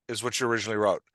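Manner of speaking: ordinary talk, nothing marked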